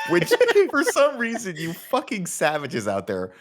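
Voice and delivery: loud, high-pitched laughing rant; mock outrage. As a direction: scold the audience in incredulous, cracked-up outrage. Mock scolding of viewers amid laughter.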